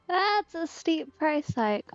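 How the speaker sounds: ordinary talk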